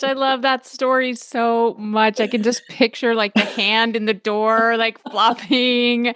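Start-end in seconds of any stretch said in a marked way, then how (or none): none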